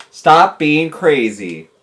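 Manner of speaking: slow, spaced-out command at normal pitch; deliberate, measured order; firm slow telling-off. Real, not acted. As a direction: spell it out slowly for a dim listener. First try at telling fish off.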